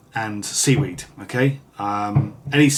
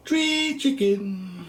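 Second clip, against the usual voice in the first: comic squeak; 11 semitones higher, much slower than usual. Pretend. Jokey name for a wood pigeon.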